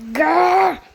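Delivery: loud playful growl; mock growl. Gamer fuming at his friends, loud mock growl. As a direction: growl like an angry dog.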